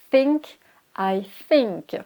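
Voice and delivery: slow model of think, tongue between teeth; airy TH in think. Shown as a demonstration. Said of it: "on a la langue qui se met entre les dents". (think lentement, langue entre les dents)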